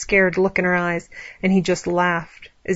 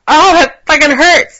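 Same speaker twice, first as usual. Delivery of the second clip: high, loud pained outcry; pained cry; 9 semitones higher, 10 dB louder, slower than usual. A narrator's reading. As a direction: cry out through sudden pain. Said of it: "Allie cried out".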